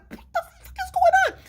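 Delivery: very high, squeaky mock panic; panicked bewilderment. Brother overhearing sister's abusive call, high squeal of alarm. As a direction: squeal it in helpless disbelief.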